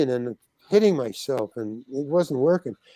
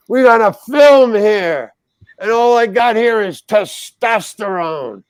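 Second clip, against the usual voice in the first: director insisting; 4 semitones higher, 6 dB louder than usual. Retold or imitated. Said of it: "So he's going".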